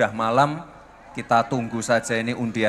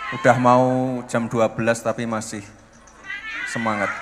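ordinary delivery

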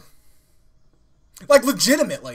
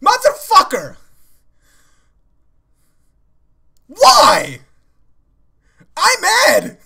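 real outraged shouting, much louder; angry yelling; 6 semitones higher, 8 dB louder, much slower than usual. Real, not acted. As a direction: yell it out, mad and in disbelief. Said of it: "I'm so sorry I'm yelling".

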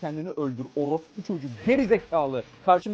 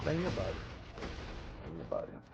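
quieting down; 10 semitones lower, 14 dB quieter, faster than usual. Real, not acted. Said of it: "niye bağırıyorsun ki".

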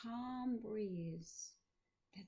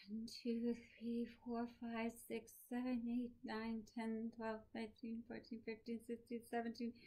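hushed counting, weakening as the breath runs down; soft, wobbly count; 5 dB quieter than usual. A narrator's reading. Dying professor showing his breath test, soft wobbly count. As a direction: count softly and unsteadily on one breath. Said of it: "in a soft, wobbly voice".

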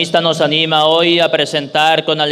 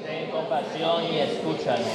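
faint, far-off congregation reply; cathedral echo; 3 semitones higher, 11 dB quieter than usual. Real, not acted. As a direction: voices answering from the back of a church. Congregation responds in church.

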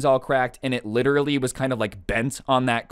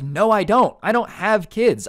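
much higher, louder and slowed, incredulous snap-back; exasperated retort; 9 semitones higher, 5 dB louder than usual. Retold or imitated. Cousin arguing with the mom about his backpack, exasperated. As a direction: shoot down her excuse, fed up.

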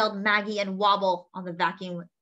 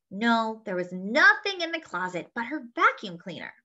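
usual speaking voice

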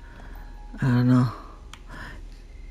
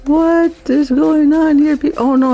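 higher, louder, slow bewildered cry; startled alarm; 8 semitones higher, 5 dB louder than usual. Real, not acted. Sudden chaos in the game, startled alarm.

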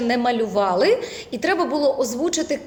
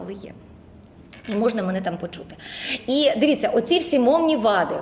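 ordinary talk, nothing marked

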